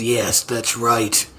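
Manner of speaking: slow menacing agreement. Acted. Slow low villain reply.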